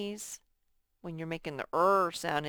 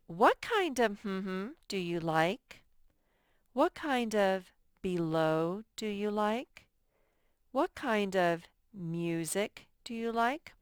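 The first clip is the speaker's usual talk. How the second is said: slow read-aloud. Shown as a demonstration. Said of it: "teacher reads sentence slowly".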